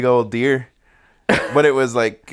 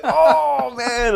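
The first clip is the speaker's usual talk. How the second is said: very high, wincing exclamation; dismayed cry; 3 dB louder than usual. Retold or imitated. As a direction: react to hearing the friend hit it. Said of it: "I was like".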